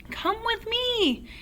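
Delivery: put-on haul the boy along eagerly; excited yell. A narrator's reading. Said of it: "Mister Cranky yelled".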